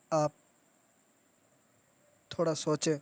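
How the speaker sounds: ordinary talk, nothing marked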